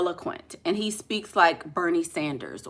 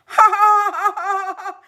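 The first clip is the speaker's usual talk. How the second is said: high, put-on society laugh; hammed-up prim titter, higher and louder; mock polite laugh; 11 semitones higher, 5 dB louder than usual. Mocking people who say pardon me, put-on prim laugh.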